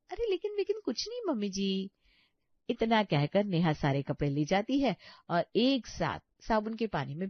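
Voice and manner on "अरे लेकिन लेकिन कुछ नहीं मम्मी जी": much higher, talking over her; shrill cutting off. Acted. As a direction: snap the objection shut. Neha cuts off mother-in-law. (ऊँची आवाज़ में बात काटती बहू)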